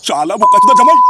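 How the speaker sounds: high, loud curse; furious shout. Acted. Father-in-law cursing the son-in-law, furious shout. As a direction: explode with the insult.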